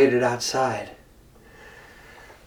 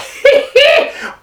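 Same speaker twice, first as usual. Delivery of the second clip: loud breathy villain cackle; high gloating cackle; 7 dB louder than usual. Acted. Jackal cackling to frighten the tiger.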